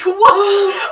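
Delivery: loud, high-pitched "what" of disbelief; shocked reaction. Retold or imitated. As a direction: blurt it out in loud astonishment. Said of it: "and I was like".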